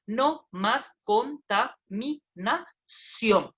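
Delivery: "no más contaminación" read very slowly, syllable by syllable. Shown as a demonstration. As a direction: stretch every syllable of the verse out. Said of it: "Por ejemplo, aquí ve".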